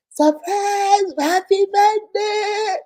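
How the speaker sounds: loud, high and drawn-out "Happy birthday!"; surprise shout. Retold or imitated. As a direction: cheer it like a surprise party.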